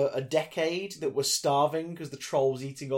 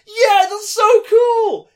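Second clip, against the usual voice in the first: high, loud, slowed gush of glee; gushing excitement; 16 dB louder, much slower than usual. Retold or imitated. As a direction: gush like a thrilled fan.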